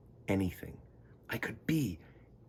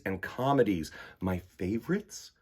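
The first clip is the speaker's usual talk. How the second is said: ordinary delivery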